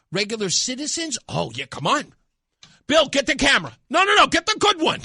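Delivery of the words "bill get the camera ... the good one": high, loud, rushed order; hammed-up eager newsroom boss; mock eager boss. News boss eagerly ordering cameraman to grab good camera.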